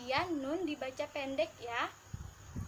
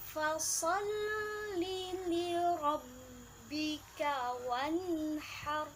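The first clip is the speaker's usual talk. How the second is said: slow melodic tajwid chant; slow chanted Quranic recitation; 2 semitones higher, much slower than usual. Shown as a demonstration. Teacher recites verse two.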